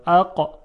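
اقا with the ق cutting the sound off; hard qaf stop. Shown as a demonstration. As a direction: block the sound completely on ق in اقا.

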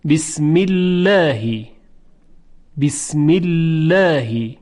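slow "بسم الله", light clear l in "الله"; delicate, forward lam after the kasra in "بسم الله"; thin lam. Shown as a demonstration. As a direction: recite "بسم الله" as a drawn-out "bismillaehi".

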